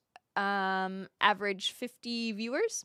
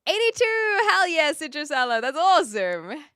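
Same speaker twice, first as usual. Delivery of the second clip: high, loud burst of joy; real delighted shout; excited cheer; 6 semitones higher, 8 dB louder than usual. Real, not acted. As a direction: cheer like the number just hit. Celebrating a chat milestone.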